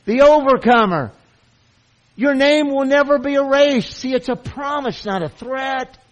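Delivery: high, loud, fervent proclamation; preaching at full force. Real, not acted. Preacher assuring the flock of the promise, full-force fervor. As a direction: proclaim it over the crowd.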